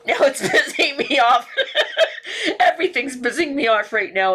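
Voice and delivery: exasperated laugh while speaking; real laughter at her own rage. Real, not acted. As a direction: laugh through the frustration.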